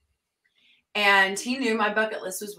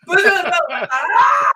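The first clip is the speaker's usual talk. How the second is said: mock crying; 10 semitones higher, 10 dB louder, slower than usual. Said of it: "She said".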